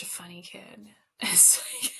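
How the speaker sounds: hushed, breathless whisper; teacher remembering a funny student in a hush; whispered fondly. Real, not acted. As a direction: whisper it like a fond secret. Fond whispered recollection.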